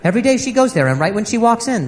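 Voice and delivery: normal talking voice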